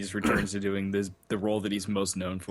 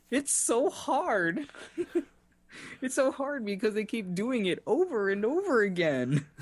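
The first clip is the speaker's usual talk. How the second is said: high, drawn-out lament; Jesus freak; 13 semitones higher, slower than usual. Retold or imitated. Quoting Amanda Bynes's movie zealot, high mock anguish. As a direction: play an overwrought zealot, pitched way up. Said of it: "plays, like, a Jesus freak".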